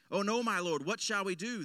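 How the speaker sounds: frightened servant asking his lord; alarmed servant. Acted. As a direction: panic as the army is spotted. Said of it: "the servant asked".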